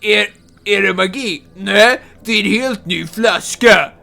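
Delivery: loud hammed commercial read in Swedish; hämad reklamröst; mock ad voice. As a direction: parrot the ad's line. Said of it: "where he says".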